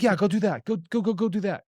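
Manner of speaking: high, rapid-fire imitation of urging; mock eager urging. Acted eager urging by captives.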